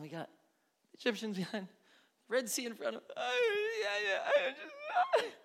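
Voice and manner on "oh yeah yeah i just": high, wailing fake sob; mock crying. Preacher mimicking Israelites crying at the Red Sea, high mock sob. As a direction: blubber like a whiny child. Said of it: "Why are you sitting here crying".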